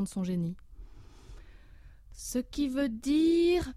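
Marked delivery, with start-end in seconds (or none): 2.1-3.7 very slow, coaxing teacher; drawn-out prompting. Acted. Professor coaxing a pupil, very slow and drawn out. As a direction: stretch it out, waiting for the answer.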